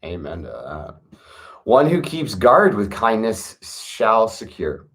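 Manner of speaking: ordinary delivery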